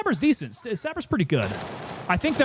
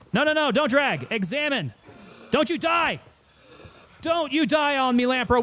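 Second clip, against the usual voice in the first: high, urgent pleading; desperate pleading; 7 semitones higher than usual. Real, not acted. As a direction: beg your dying friend to hold on. Teammate dying, desperate pleading.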